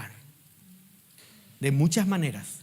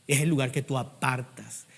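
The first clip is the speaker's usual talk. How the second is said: ordinary talk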